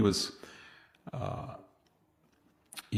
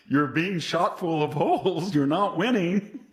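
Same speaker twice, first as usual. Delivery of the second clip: higher, louder, slow and emphatic; mock exasperated scolding; 8 semitones higher, 5 dB louder than usual. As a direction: scold him slowly, as if stating the obvious. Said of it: "you want to stop and say".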